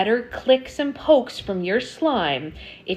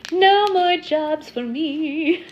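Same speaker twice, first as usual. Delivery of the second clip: high, slow, whiny mock voice; 9 semitones higher, much slower than usual. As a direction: whine it like a worn-out kid. Voicing herself tired, laughing.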